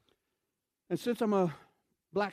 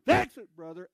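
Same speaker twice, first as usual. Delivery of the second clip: imitating the man still yelling at the preacher; mock yell; 3 semitones higher, 8 dB louder than usual. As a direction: shout it across the hall. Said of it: "he just kept on yelling".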